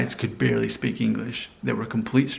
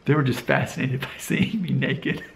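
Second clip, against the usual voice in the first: words broken by real giggles; giggling at his own story; laughing while telling; 4 semitones higher than usual. Real, not acted. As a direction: laugh through the embarrassing bit. Laughing at embarrassing sauna memory.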